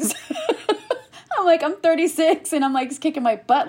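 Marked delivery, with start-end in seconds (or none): 0.3-3.7 laughing through the words, pitched up. Real, not acted. Guest laughing as she tells the host about chemo. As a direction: giggle as you get it out.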